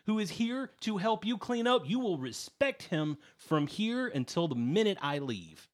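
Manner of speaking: high, loud furious scolding, fading down at the end; angry confrontation. Acted. Gnome Nitsi angrily confronts Valette over the boy. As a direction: tear into her for bullying. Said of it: "stand up at full height and go".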